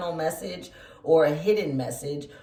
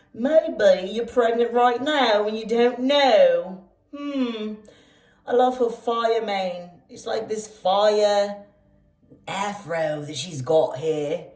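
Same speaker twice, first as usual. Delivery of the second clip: playful, higher-pitched mock British accent; fake British accent; 5 semitones higher, 5 dB louder, slower than usual. Tarot reader musing over the Empress card, in fake British accent. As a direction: put on a hammed-up British voice. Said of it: "with my fake British accent".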